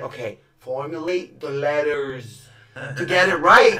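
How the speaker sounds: form each word slowly, as if coaching yourself; careful self-correction. Acted. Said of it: "I have to go back and be like".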